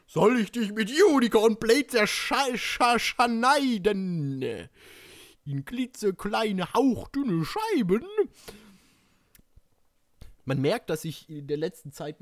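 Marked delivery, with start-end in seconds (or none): 0.1-8.1 high, drawn-out, stuttered threat; hammed-up reading. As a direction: stretch the syllables out theatrically. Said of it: "Annäherungsversuche lassen wir jetzt mal".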